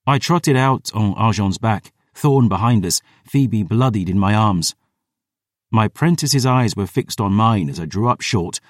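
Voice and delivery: usual speaking voice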